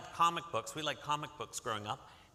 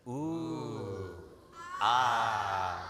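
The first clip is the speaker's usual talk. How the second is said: ooh then a low louder ah; ooh ah of admiration; 4 semitones lower, 3 dB louder than usual. Shown as a demonstration. Teacher leading audience in admiring ooh and ah. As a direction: lead the crowd in awe. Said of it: "let's try that together".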